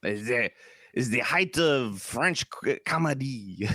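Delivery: put-on drawn-out comic French accent; mock French accent. Joking about the height of French comedy, mock French accent. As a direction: pronounce it as a hammed-up Frenchman would.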